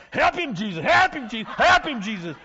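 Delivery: high, loud church lady yelling; hammed-up congregation yelling; yelled plea. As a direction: holler it over and over from the pew. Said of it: "they were yelling".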